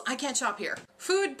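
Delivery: ordinary delivery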